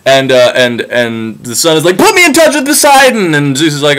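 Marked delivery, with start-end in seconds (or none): 2.0-3.3 much higher, louder indignant whine; high shrill-toned petulant demands; whiny sun god. Retold or imitated. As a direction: complain like a spoiled god missing his cows.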